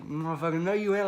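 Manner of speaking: high-pitched indignant comeback; amused retelling of an angry retort; retold anger. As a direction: fire back in mock outrage. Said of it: "I know I had that anger".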